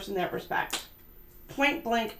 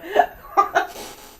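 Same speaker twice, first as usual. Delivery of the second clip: high loud giggle; high laugh; 11 semitones higher, 5 dB louder than usual. Real, not acted. The host laughing at herself, a high giggle. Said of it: "laughing burst".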